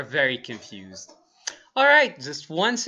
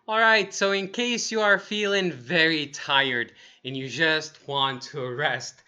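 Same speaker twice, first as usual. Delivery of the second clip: ordinary talk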